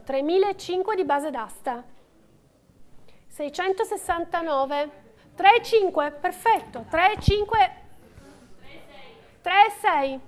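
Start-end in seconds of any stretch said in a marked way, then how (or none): 5.3-10.2 steady rhythmic bid calling; auctioneer calling bids. Real, not acted. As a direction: call out each bid to the room.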